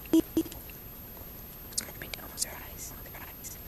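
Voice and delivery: whisper. Real, not acted. Mom whispers to baby.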